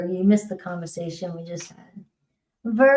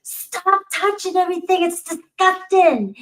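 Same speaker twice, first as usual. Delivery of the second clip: high, sharp scolding, much higher; mother scolding; 10 semitones higher than usual. Retold or imitated. Mother in a restroom stall scolding her little girl, high and cross. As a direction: snap at a child touching everything. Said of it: "the mother gets mad and goes".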